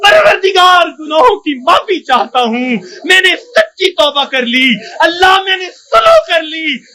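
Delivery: high, crying plea to God; preacher weeping while calling on the Lord; tearful impassioned preaching. Acted. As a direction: cry out the prayer from the heart.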